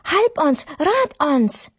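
high, urgent pleading to wake him; desperate cry for help; frantic calling. Acted. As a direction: shake the sleeper awake with fear. Disciples waking Jesus in storm.

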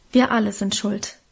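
ordinary delivery

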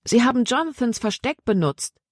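high, eager exclamation; excited exclamation. A narrator's reading. Libby realises how the children hid, excited exclamation. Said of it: "rief Libby aus".

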